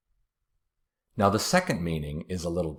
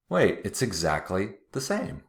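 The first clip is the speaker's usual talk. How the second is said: ordinary delivery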